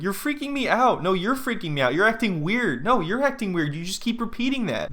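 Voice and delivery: high-pitched back-and-forth squabble; hammed-up accusations tossed back and forth; mock bickering. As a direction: imitate a couple's rising, petty argument. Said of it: "going back and forth".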